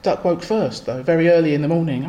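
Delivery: plain reading voice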